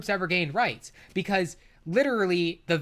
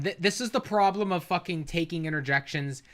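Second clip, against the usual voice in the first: ordinary delivery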